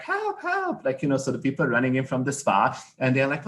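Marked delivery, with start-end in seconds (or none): 0.0-0.8 mock screaming. Spa guests running in, imitated with a high mock scream. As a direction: squeal it high as a retold scream. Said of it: "starts screaming like".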